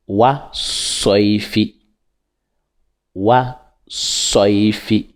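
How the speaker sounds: "Wa soifi" slowly, pressing the s; slow recitation. Shown as a demonstration. Teacher reciting the sun letter slowly for pupils. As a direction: lean on the "so" and take it slowly. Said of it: "on appuie dessus en disant soif".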